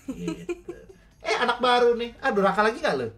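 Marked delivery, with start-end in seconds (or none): 1.2-3.1 laughing mother. Acted. Mother voiced in story.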